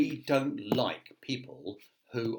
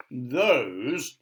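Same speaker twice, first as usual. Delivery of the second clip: grand wizard voice; 2 semitones higher, 7 dB louder than usual. Acted. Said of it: "all wizards speak in a very grand voice".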